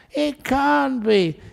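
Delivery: mock stubborn insisting; lightly hammed-up wife digging in; insistent wife.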